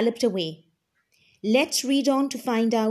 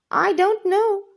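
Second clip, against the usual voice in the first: much higher little gnome voice; unsure gnome; 9 semitones higher, 4 dB louder than usual. Acted. Gnome answering Wilma about the lemon, high and unsure. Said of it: "said the gnome".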